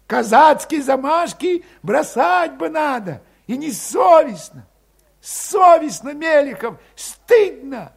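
high, loud, shaming yell; indignant shout. A narrator's reading. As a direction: shame him loudly, high and slow. Valet shouts at Grigory.

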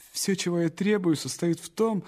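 plain reading voice

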